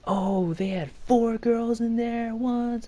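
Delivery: high, slow, drawn-out awe; mock rumor-spreading guys. Guys on the bus starting rumors, high slow mock hype. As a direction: imitate hyped-up gossip, voice way up. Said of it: "they start those rumors".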